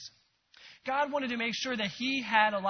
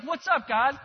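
bold greeting; 4 semitones higher, 7 dB louder than usual. Retold or imitated. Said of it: "he goes in with this boldness".

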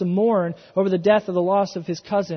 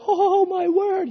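high, slow mock astonishment; amazed gasp; 12 semitones higher, 4 dB louder, slower than usual. Believer stepping onto the water, mock amazed. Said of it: "and we're like".

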